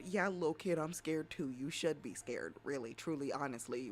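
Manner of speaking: ordinary delivery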